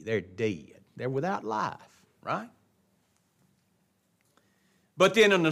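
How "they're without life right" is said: usual speaking voice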